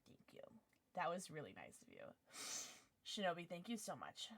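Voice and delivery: very soft, choked-up thanks; small, low, tearful gratitude; trying not to cry. Real, not acted. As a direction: thank them quietly while holding back tears. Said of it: "I'm trying not to cry".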